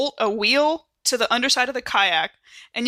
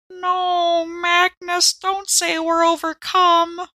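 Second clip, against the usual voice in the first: higher, slow moaning plea; moaning sotto voce; 9 semitones higher, 3 dB louder, slower than usual. A narrator's reading.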